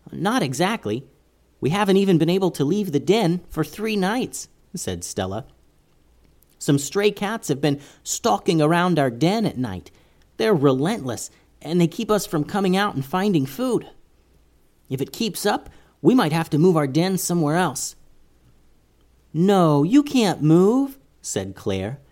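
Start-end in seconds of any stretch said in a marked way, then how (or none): 0.1-17.9 higher small mouse voice; tiny squeaky critter. Acted. Stella the mouse speaks. 19.3-21.0 pleading. Acted. Claire pleads with mice.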